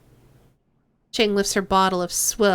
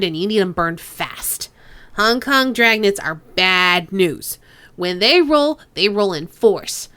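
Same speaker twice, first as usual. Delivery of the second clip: put-on Chang's nasal accent, getting down to business; 4 dB louder than usual. Boss Chang warning the players of the dragnet, nasal accent. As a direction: warn them hard in Chang's nasal accent. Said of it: "to do Kindly Chang's accent".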